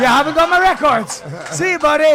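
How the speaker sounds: high, loud, cheery brush-off; walk away pretending nothing happened; casual goodbye to the tied-up man; breezy dismissal. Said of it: "I go".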